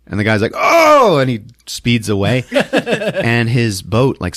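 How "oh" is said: high, loud mock-horrified "oh"; startled yelp. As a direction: cry out in comic shock. Boatman recoils at bug face.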